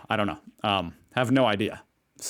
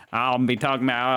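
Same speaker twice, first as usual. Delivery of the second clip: normal talking voice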